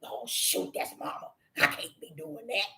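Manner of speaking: whispered, ashamed dog voice; whispered apology from a dog with its ears down; dog's sheepish whisper. Retold or imitated. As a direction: hush it like a caught dog. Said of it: "And he put them ears down".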